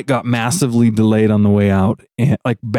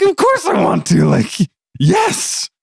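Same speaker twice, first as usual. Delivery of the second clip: high, eager shout of joy; thrilled yes; 8 semitones higher, slower than usual. Retold or imitated. Accepting the World Championship spot, thrilled high yes. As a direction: gush with disbelieving delight. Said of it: "I'm like".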